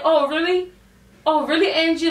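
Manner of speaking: acted-out indignant "oh, really"; retold affronted challenge. Retelling her challenge to Angie.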